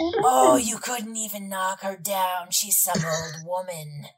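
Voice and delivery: slow, contemptuous sneer; mock her slowly like an unseen spirit; taunting shadow voice. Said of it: "And the voice says again".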